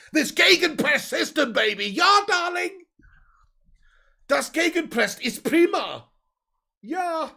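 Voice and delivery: put-on high, loud, drawn-out German accent; hammed-up high German voice. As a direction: shout it out slowly as an excitable high-pitched German. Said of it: "Oh, pig, the German accent".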